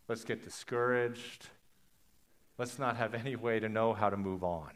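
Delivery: very soft, halting defeat; quiet resignation. Acted. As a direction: give up softly, with long pauses. Voices the people giving up.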